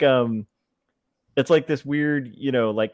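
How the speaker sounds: ordinary talk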